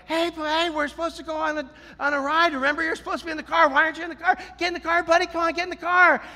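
high, fast pet-talk imitation; coaxing dog owner. Owner urging his dog into the car, high-pitched.

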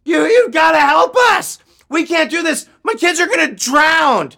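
high, loud shouted plea; frantic full-voiced yelling; desperate scream. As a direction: scream for help as if lives depend on it. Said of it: "I screamed".

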